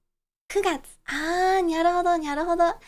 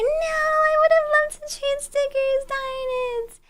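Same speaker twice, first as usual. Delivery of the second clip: mock whine; 12 semitones higher, 4 dB louder than usual. Real, not acted. Missed trading stickers.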